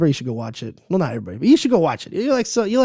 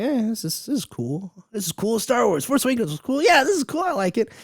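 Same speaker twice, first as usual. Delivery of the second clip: easily pleased; 8 semitones higher than usual. Retold or imitated. Recalling first watching the film, easily pleased. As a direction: imitate yourself talking yourself into liking it. Acted past reaction while watching.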